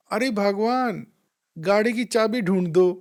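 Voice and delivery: imitating a friend yelling for his car keys; loud call inside. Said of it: "उसने ज़ोर से अंदर आवाज़ दी".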